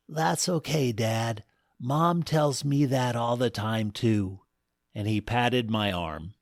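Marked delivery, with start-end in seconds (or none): none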